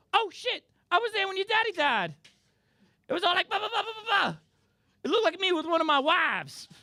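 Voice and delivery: high, loud raspy soul-man voice. Retold or imitated. [name] cameo voice in Creed.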